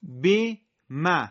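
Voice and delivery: بی ما spelled out very slowly, syllable by syllable; teacher dictating slowly for repetition; drawn-out syllables; slow model reading. Shown as a demonstration. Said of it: "بعد من تکرار بفرمایید".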